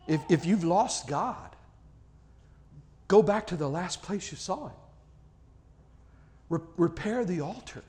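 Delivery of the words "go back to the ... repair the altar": normal talking voice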